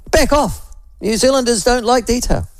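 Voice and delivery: high-pitched mocking imitation; Chris Hipkins. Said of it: "Chris Hipkins holds up his hand and he says".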